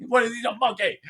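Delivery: mocking his kids. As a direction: mimic upset kids rattling off nerd logic. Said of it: "And they're like".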